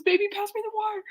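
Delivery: high, breathless imitated plea; mock agony. Imitating her sister begging hubby for water, mock agony. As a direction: mimic a desperate squeak, mouth on fire, rushed.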